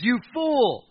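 acted accusing cry; exclaimed insult. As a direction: hurl it with an exclamation point. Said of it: "there's an exclamation point with fool".

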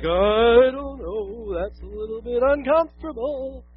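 very drawn-out, squirming hesitation; hammed-up uncomfortable hedging; mock reluctance. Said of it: "this spot where we're like".

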